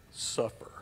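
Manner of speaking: unvoiced mock threat "suffer"; joking whisper. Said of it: "that's not really what that means".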